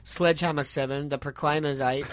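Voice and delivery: ordinary delivery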